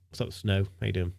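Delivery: ordinary delivery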